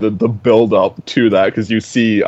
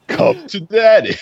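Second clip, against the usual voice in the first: slow, high creepy "Come to daddy"; mock-sinister quote of the film's villain; creepy villain line; 11 semitones higher, 4 dB louder than usual. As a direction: draw it out like a leering villain. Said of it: "he's saying it so weird".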